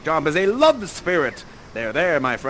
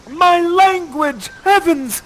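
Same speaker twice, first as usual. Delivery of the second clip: much higher, louder, slow and theatrical; hammed-up rapture; 13 semitones higher, 11 dB louder, slower than usual. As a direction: overact his joy and grief. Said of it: "You say".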